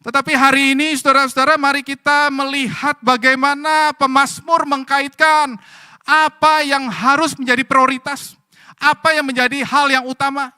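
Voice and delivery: loud, high impassioned sermon; rally the congregation to look to God; full-force preaching. Acted. (khotbah berapi-api dengan suara keras)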